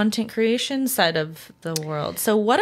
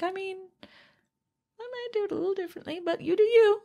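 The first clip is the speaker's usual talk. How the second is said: higher and softer, quick mock thought; inner voice; 13 semitones higher, 4 dB quieter, faster than usual. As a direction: rattle off a dismissive inner thought. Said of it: "in my head sometimes I'm like".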